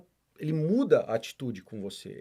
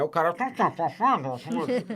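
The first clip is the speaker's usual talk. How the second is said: rattling tá tá tá, imitating a garbled drive-thru voice; mock gibberish; 3 semitones higher, 3 dB louder, slower than usual. Customer mimicking a drive-thru speaker, rattling gibberish. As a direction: rattle off nonsense syllables like a crackly speaker.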